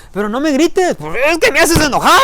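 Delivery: put-on high-pitched whiny teen retort; daughter talking back. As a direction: daughter answering her father back in a high whine. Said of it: "Y le dice la hija".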